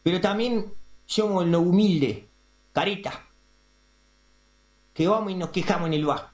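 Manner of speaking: ordinary talk, nothing marked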